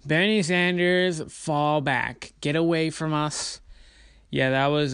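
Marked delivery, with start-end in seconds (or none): 0.1-3.6 slow, higher-pitched mock dismissal; mocking a critic. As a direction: imitate a dismissive critic, drawn out and raised. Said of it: "woman who was like".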